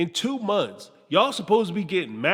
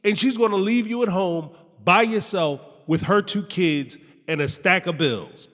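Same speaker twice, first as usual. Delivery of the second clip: usual speaking voice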